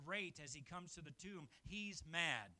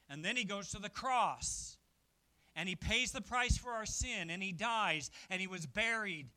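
loud, rising sermon climax; emphatic pulpit proclamation; preaching at full force; 9 dB louder than usual. Acted. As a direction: hammer each step home to the congregation.

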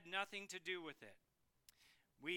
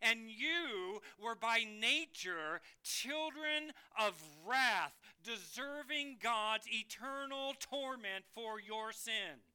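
preached with force; 3 semitones higher, 6 dB louder, slower than usual. Acted.

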